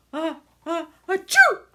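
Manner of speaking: loud high sneeze building up; ah ah then a big achoo. A narrator's reading. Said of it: "sneezed a voice".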